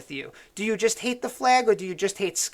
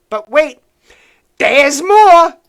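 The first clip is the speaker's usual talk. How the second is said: much higher and much louder salesman shout; infomercial hype; 7 semitones higher, 13 dB louder, much slower than usual. Acted. Host teasing viewers with more hate, loud pitchman shout. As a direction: sell it like a late-night TV pitchman.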